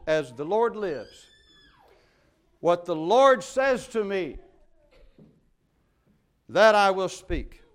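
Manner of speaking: slow, rising to a loud declaration; mild firm prophet's vow; solemn vow. Acted. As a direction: proclaim it like an oath.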